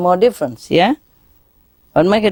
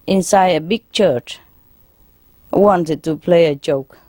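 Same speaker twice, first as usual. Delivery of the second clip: ordinary delivery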